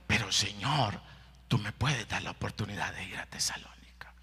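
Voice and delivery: softly whispered plea to God; whispered prayer. Acted. Paul whispers his prayer to the Lord, hushed.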